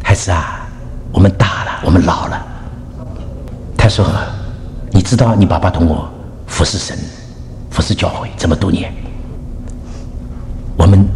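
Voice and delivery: low, heavy, halting words; retold tearful mother. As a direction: speak low to your child, with long pauses. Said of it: "沈师母有一点流泪的样子".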